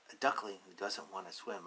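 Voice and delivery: ordinary delivery, nothing marked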